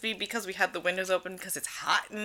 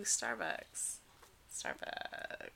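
hushed Starbucks; 10 semitones lower, 20 dB quieter, much slower than usual. Pretend. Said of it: "like, ooh".

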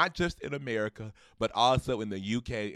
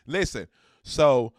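ordinary talk

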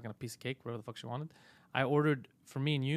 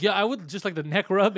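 louder, high casual request; mock nonchalant client; mock friend; 5 semitones higher, 9 dB louder than usual. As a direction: say it like a clueless client. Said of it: "You're like".

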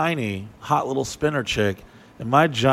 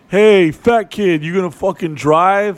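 slow, loud, higher jeer of a cocky buddy; calling him fat kid across the room; mocking friend; 4 semitones higher, 6 dB louder than usual. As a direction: drag out the taunt like a smug jerk. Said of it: "Sock Dick Dave's like".

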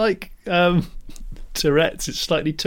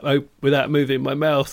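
ordinary delivery

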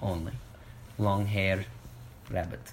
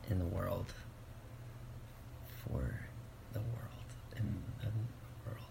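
solemn; 10 dB quieter than usual. Acted. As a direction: deliver it like a sacred vow. Slow earnest mission statement.

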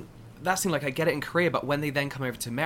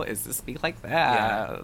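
slow, drawled English take on American; mock American accent; 3 semitones lower, much slower than usual. Shown as a demonstration. English speaker showing default American accent, mock American. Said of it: "the default American accent for English people".

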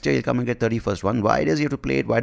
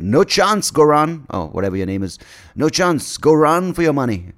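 high, loud mock dismissal; prime minister's refusal; 7 semitones higher, 5 dB louder than usual. Comedian voices the prime minister refusing Goran, high and loud. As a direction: play a blustering politician shutting someone down. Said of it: "the Prime Minister fucking said".

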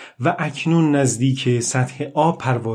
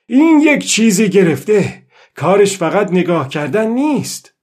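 put-on raised, higher-pitched voice of the old fisherman; بلند و رسا; 6 semitones higher, 4 dB louder than usual. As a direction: speak up loudly, pitch lifted. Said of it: "با صدای بلند گفت". (با صدای بلند)

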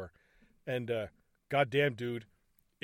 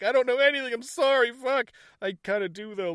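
higher, louder, a mock panicky rush of words; panicked babbling; 9 semitones higher, 5 dB louder than usual. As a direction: blurt it out like a cornered man, half joking. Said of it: "he's just like".

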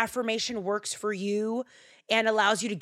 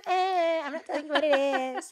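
higher, sing-song teasing "I'm not telling you"; teasing refusal; 7 semitones higher than usual. Shown as a demonstration. Host teasing podcast listeners about a secret phrase, playful sing-song. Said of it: "I'm so naughty".

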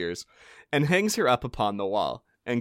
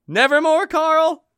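high taunting shout; 9 dB louder, slower than usual. Acted. Molly's mocking reply.

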